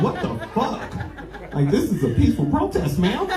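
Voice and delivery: high, breathy half-laughing disbelief; shocked disbelief. Retold or imitated. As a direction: play it shocked at the lady. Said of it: "I was like".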